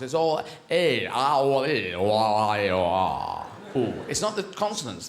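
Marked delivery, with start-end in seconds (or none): 0.7-3.9 slow run of "A, ah, O, E, O, I" sinking in pitch; sliding vowel noises. Shown as a demonstration. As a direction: smear the vowels into one long, descending slide. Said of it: "they're all changes in vowels".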